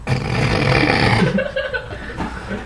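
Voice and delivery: high unvoiced giggle; breathy laugh. Real, not acted. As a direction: laugh through the words. Laughing at own reading.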